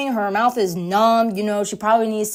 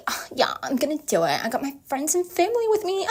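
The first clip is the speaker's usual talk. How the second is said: high imitation with a tiny giggle at the end; mock girlish Lucy; 11 semitones higher than usual. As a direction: climb into a high, hammed-up girly voice. Voicing Lucy agreeing, ending in giggle.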